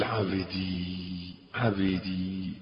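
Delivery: soft, low, hushed calling; tender whisper. Pretend. God calling His servant, softly and tenderly. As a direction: call to the servant as gently as breath. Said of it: "تسمع ربك بيقول".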